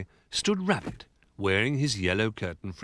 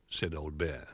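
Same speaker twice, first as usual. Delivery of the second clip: plain reading voice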